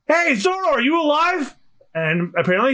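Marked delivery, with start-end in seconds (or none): 0.1-1.6 high-pitched, urgent call. Acted. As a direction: holler to a friend buried in rubble. Calling to friend under the rubble.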